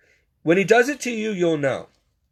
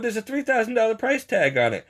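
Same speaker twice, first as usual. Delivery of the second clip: higher-pitched incredulous objection; mock disbelief, pitched up; retold protest; 7 semitones higher than usual.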